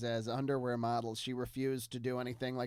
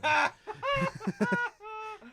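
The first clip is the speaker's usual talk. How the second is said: high burst of laughter. Real, not acted. Laugh after "rear view" name.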